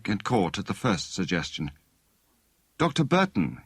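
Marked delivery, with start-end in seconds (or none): 2.8-3.6 firm commanding call, pitched higher; authoritative. A narrator's reading. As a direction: take charge of the room with the name. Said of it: "he said authoritatively".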